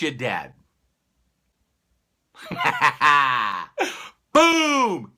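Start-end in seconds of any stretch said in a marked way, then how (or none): 2.5-4.1 burst of laughter. Real, not acted. Laughs at own joke. 4.3-5.1 high, loud Boom!. Acted. Telling the pistol story, a loud mock shot. Gunshot sound effect.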